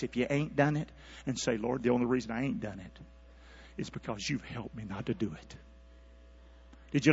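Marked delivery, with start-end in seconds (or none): 1.6-5.6 low, hushed confession to God; humble prayer. Acted. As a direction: sink into a soft, low prayer. Models prayer on one's face.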